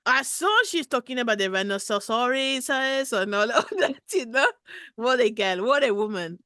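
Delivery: lightly put-on French accent; a hint of a Parisian caller; mild mock French accent. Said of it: "dialing code of my accent".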